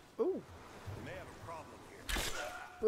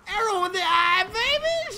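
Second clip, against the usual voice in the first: fast taunt; 9 semitones higher than usual. Real, not acted. Gamer teasing the enemy player, fast and ending high.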